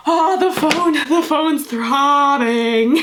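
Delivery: high, loud, slow, cracking up; barely getting the words out for laughter; laughing through it. Real, not acted. Reading the silly line to viewers while cracking up, high and loud.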